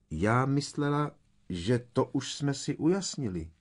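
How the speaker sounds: light, high, exasperatingly calm; maddeningly matter-of-fact. A narrator's reading. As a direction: point it out with infuriating innocence. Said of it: "tímtéž rozčilujícím hlasem".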